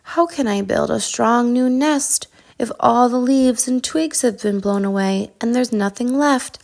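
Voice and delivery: ordinary delivery, nothing marked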